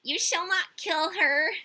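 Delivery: put-on high, loud, slow child voice; little daughter crying. A narrator's reading. Robber's daughter stops her mother, high and slow. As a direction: plead it like a spoiled girl. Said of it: "cried her little".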